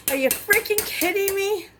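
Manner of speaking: high, incredulous cry of frustration; can't believe the thermometer broke; exasperated disbelief. Real, not acted. Thermometer broke, recipe ruined.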